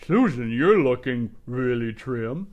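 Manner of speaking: loud, booming God voice; a hammed-up, loud God with an odd tone; movie God voice. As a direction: boom it out like God in a film. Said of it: "God always talks low in all those movies".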